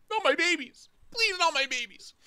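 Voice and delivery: high-pitched hammed-up begging; mock pleading. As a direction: beg like a panicked parent in a skit. Acting a debtor pleading for children.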